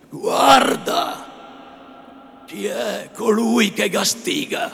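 loud high preaching thunder; full resonant rebuke. A narrator's reading. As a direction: rain judgment down on him. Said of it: "ripresa tutta l'antica pienezza e sonorità".

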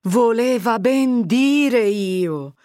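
lightly voiced village mother, glad; Agnese exclaiming. Agnese, relieved at Fermo's story, exclaiming slowly.

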